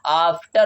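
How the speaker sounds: slow AAF-ter, long first vowel; long-aa pronunciation. Shown as a demonstration. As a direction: stretch the AA in "after". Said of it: "बड़ा आ में हो रहा है". ("after" में आ को खींचकर)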